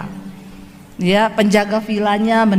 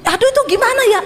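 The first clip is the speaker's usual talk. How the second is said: much higher exasperated sigh; fretting businessman; 12 semitones higher, much faster than usual. Retold or imitated. Rich man fretting over yet another phone call, higher and exasperated. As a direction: whine it like a harried boss.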